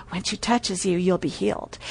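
hushed, low retold murmur; speaking in his ear. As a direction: lean in and murmur it softly. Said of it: "lean over and speak in his ear".

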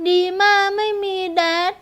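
put-on high little-girl voice, tearful ending; child's trembling voice. A narrator's reading. Fatherless girl pointing to heaven, trembling child voice. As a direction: let the child's last words waver. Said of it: "หางเสียงสั่นพร่า".

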